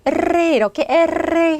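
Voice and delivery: loosen the tongue and roll the r in 에레. Shown as a demonstration. Teacher showing the tongue vibration, 에레 with a long trill. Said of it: "혀를 이렇게 부드럽게 해서".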